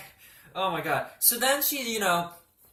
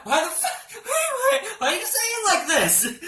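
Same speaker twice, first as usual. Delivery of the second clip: high, loud mock-panicked protest; squealing protest through laughter; flustered panic; 4 dB louder than usual. Patient protests at how the doctor says it, flustered panic.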